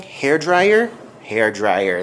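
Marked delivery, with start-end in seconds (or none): 0.0-0.9 higher and louder, rising like a happy find; delighted little exclamation, pitched up; excited discovery. Real, not acted. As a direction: announce it like you just found treasure. Finds hair dryer, then repeats.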